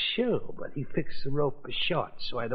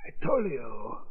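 usual speaking voice

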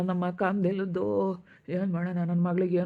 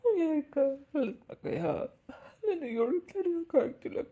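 high-pitched crying complaint; sobbing, unable to bear it; tearful wail; 7 semitones higher than usual. Acted. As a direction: break down as you speak. Weeping, cannot bear it.

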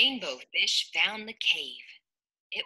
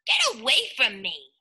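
haughty fish voice; 4 semitones higher, 3 dB louder than usual. Acted. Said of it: "cried the Rainbow Fish".

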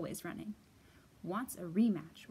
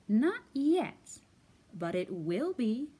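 eager salesman; 6 dB louder, slower than usual. Acted. Fox salesman reply.

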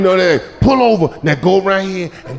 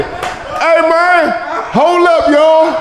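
mock shout to the boys; 9 semitones higher, slower than usual. Drunk man yelling to his friends to hold up. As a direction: holler at your buddies like you really gotta go. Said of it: "We say".

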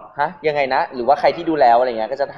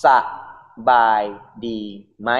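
syllable by syllable, with pauses between; slow teaching demo; 2 semitones lower than usual. Shown as a demonstration. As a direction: teach it slowly, one syllable at a time. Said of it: "สอนแบบช้าๆหน่อยได้ไหมคะ". (พูดช้าๆ แยกทีละพยางค์)

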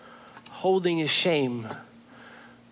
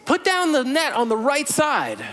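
calling out; 9 semitones higher than usual. Retold or imitated. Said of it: "Jesus yells to them".

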